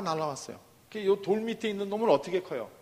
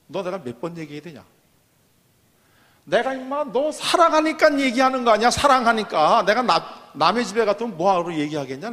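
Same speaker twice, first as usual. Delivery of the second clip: louder, heated mother pleading and scolding; a mother yelling at her stubborn child, mock-heated; angry mother scolding; 8 dB louder than usual. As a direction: scold out of exasperated love. Mother scolding bean plant angrily.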